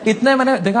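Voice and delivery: usual speaking voice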